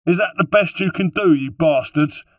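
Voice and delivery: high, loud taunt; jeering battle cry; defiant shout. A narrator's reading. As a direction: yell a challenge at the attackers. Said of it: "Holden's voice growing fainter now".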